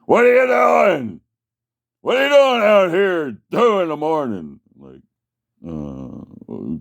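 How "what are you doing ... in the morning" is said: high, loud barking cop; mock cop shout. Cop pulling up at two a.m., loud high mock bark. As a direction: yell it like a suspicious cop. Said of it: "So he's like".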